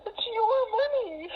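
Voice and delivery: crying to her daughter over the money she lost; real crying. Real, not acted. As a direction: sob the words out, barely holding together. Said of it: "Please don't cry".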